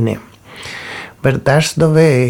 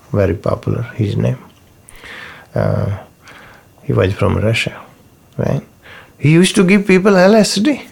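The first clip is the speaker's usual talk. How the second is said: ordinary talk, nothing marked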